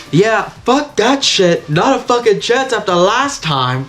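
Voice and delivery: lightly acted indignant character; Pomni. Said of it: "And then Pomni goes".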